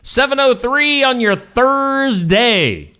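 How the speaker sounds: high, slow announcer sing-out; radio time call. Real, not acted. Host announcing time and day to listeners, announcer-style.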